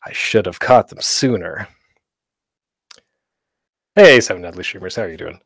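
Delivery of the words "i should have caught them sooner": slow, softer, lower lament; dragging regret. Acted. Editor hears a slow take too late, dragging regret. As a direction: drag it out like a weary editor. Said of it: "and you're like".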